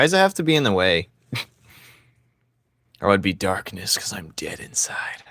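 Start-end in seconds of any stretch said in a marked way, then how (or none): 3.0-5.2 spooky whisper, much softer. Pretend. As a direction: breathe it like a dead-inside ghost. Dead inside, mock creepy aside.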